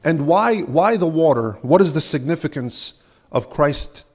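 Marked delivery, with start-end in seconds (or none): none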